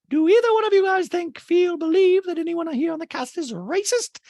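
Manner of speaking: high, loud put-on voice; Dorit. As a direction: ask it in a high, pressing mock voice. Acting out Dorit in reunion argument.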